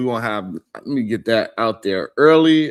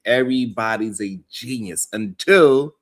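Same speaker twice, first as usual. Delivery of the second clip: ordinary talk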